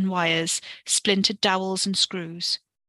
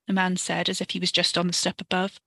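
ordinary delivery

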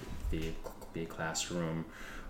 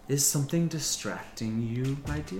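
stern nun; 6 semitones higher than usual. Acted. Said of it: "you hear Mother Superior's voice".

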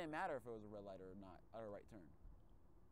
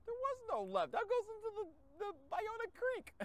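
very high, squeaky indignant voice; squeaky protest; 7 dB louder than usual. Retold or imitated. Driver protesting to Google, very high squeak. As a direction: squeal it out in disbelief.

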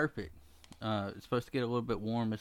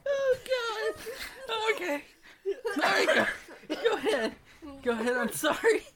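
laughing hard; 3 dB louder than usual. Real, not acted. Laughing fit while friend tells story.

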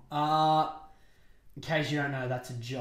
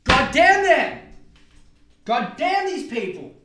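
high, hammed-up furious shout; joking, full-volume cursing; mock outrage; 9 semitones higher, 12 dB louder than usual. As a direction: rage at them theatrically. Hammed-up outrage, then "jokes aside.

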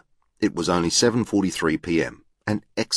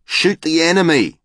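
high, loud eager shout; acted excited boy's cry; boy calling out; 11 semitones higher, 6 dB louder than usual. A narrator's reading. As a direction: call out the answer, high and loud. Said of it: "called out".

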